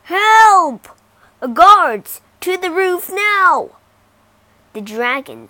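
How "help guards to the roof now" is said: loud, high panicked yell; frantic royal scream for help. Acted. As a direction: scream for the guards. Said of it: "The king screamed".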